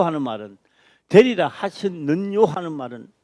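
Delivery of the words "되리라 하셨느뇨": 되리라 하셨느뇨 loud, high and slow, rising; questioning emphasis. Shown as a demonstration. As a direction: hit 되리라 hard and lift 하셨느뇨 as a question. Stresses the questioning verse ending.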